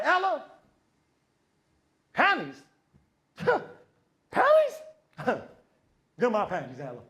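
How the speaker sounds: overacted line reading, pitch leaping high; stilted, over-the-top bad audition with big pauses; deliberately terrible acting. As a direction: ham it up as the worst actor ever. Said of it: "I'm gonna give the worst".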